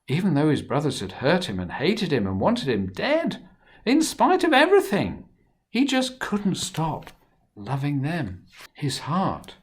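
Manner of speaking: lively, expressive storybook reading; reading to children. A narrator's reading. Storyteller reading Joseph's story to children, animated. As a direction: tell it to the little ones with big feeling.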